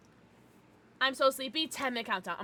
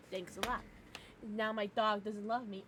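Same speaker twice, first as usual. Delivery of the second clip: normal talking voice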